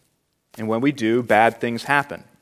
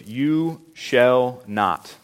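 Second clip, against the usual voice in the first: "You shall not" drawn out slowly, word by word; slow, weighty stress on each word of "You shall not"; emphatic; much slower than usual. Shown as a demonstration. Preacher points out the command's first three words, emphatic. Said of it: "this is emphasized".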